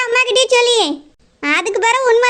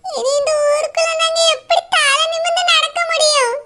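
put-on higher, tearful old woman lamenting; weeping grandmother; 5 semitones higher than usual. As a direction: wail over the family's shame. Grandmother laments runaway son. (அவமானத்தால் அழுது புலம்பும் பாட்டி)

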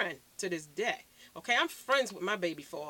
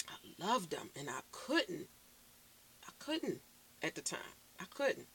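much softer, trailing, hurt; quiet pained confession; 3 semitones lower, 9 dB quieter than usual. Real, not acted. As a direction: let the words drop off quietly. Confessing painful past love.

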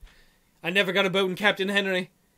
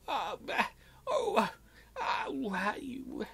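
hammed-up sob fading to a whisper; fake-cry then whisper it out; mock sobbing; 3 semitones higher, 12 dB quieter, much slower than usual.

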